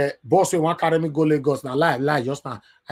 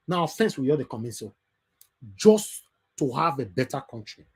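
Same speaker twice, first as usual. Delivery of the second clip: ordinary talk, nothing marked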